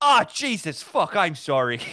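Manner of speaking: hammed-up Irish aunt apologizing, half laughing; mock Irish aunt. Aunt apologizing after the funeral flowers, in an Irish voice. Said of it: "She went".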